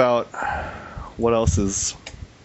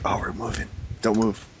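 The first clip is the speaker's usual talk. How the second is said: lightly hushed roach chatter; roaches talking; 7 dB quieter than usual. Acted. Roaches warning each other inside a carried drawer, lightly hushed. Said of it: "talking to each other".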